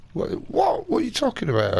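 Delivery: high-pitched, loud mock disbelief; incredulous. Customer hears his seeder is out of stock, incredulous. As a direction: act out being gobsmacked and indignant.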